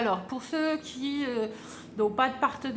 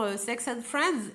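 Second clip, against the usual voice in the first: ordinary delivery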